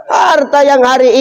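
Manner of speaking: high, slow shouted warning; forceful preaching. Real, not acted. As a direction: thunder it at the crowd.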